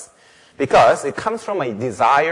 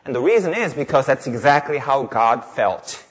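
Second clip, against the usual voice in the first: normal talking voice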